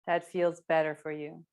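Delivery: ordinary delivery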